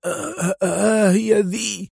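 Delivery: high, slow, trembling cry; frightened exclamation. A narrator's reading. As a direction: blurt it out high, in fear. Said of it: "بأصابع مرتجفة وفتحه هاتفا".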